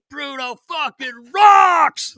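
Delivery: put-on slow, high, loud drunken yell; drunken shout. Drunk woman yelling to the coach on the bus, slow high shout. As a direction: belt it out like a tipsy fan. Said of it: "Like that".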